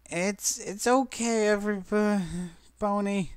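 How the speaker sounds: high, slow stutter through chattering teeth. A narrator's reading. Pinkie insisting to friends, stuttering with chattering teeth. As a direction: shiver out the words. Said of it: "stuttering her words through her chattering teeth".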